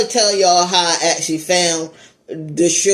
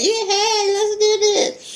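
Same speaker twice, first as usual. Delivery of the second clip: much higher, eager mock girl voice; eager woman; slower than usual. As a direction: play the too-willing woman, mocking. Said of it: "some women will be like".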